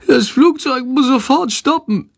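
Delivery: high, strained bat moan; groaning. A narrator's reading. Sick bat demands the plane stop, groaning high. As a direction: plead in a squeaky suffering voice. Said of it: "stöhnt Shandor".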